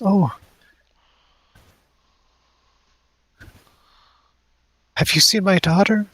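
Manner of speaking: high, tearful plea from a worried mother; weepy. Acted. Crying mother asks the stranger at her door, weepy. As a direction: ask it in a high, weepy character voice. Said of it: "that weepy kinda voice".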